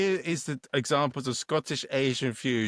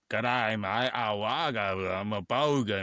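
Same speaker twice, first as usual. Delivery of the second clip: hammed-up Aussie bogan accent; drawn-out mock Australian greeting; 3 semitones lower, slower than usual. Mimicking Aussies to co-host, broad mock Australian accent. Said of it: "what about the Aussies? We're like".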